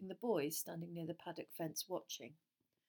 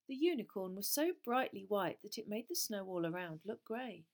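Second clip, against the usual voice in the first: ordinary delivery, nothing marked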